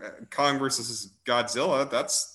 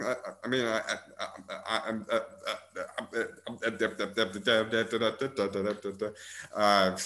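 laughing stammer; 4 semitones lower, 5 dB quieter, faster than usual. Real, not acted. Stammering laughter, lost for words.

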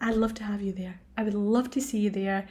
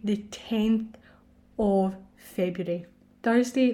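normal talking voice

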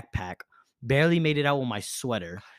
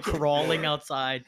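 ordinary delivery, nothing marked

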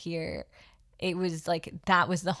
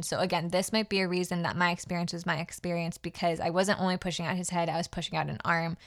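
normal talking voice

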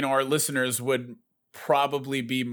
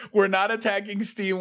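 laughing; 7 semitones higher than usual. Real, not acted. Laughing reassurance about Steam.

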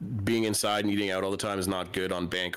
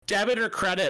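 put-on teenage drive-thru girl; 9 semitones higher, 3 dB louder than usual. Imitates giggling window girls.

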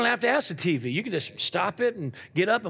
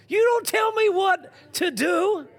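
put-on defiant child; 11 semitones higher, 4 dB louder than usual.

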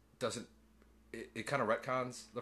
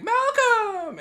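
much higher and louder, calling out with a laugh; excited call; 12 dB louder than usual. Retold or imitated. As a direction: shout his name happily across the room.

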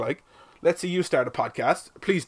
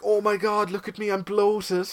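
high, drawn-out whiny show-off; imitating an attention-seeking Instagrammer; mock Instagram influencer; 6 semitones higher than usual. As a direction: put on a higher-pitched mocking voice of an online poser. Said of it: "You're one on Instagram. She's like".

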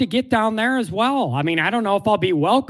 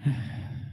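heavy sigh; 11 dB quieter than usual. Real, not acted. Asked for score prediction.

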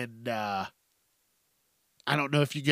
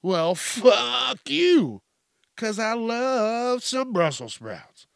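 mock defiance; 8 semitones higher, 3 dB louder, slower than usual. Real, not acted. Defending Brussels sprouts to haters.

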